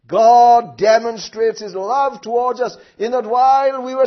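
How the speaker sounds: loud, slow fervent preaching; preaching at full force. Acted. Preacher pressing God's love on the congregation, fervent and loud. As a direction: hammer it home to the congregation.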